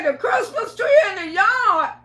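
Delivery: high, loud disbelieving cry; can't believe what you hear; shocked disbelief. Retold or imitated. Woman learns her tree is in the yard, shocked.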